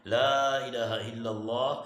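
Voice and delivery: loud, slow recitation with a long first vowel; drawn-out, ringing Arabic phrase; kalima recitation. Real, not acted. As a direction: proclaim it, stretching the vowels.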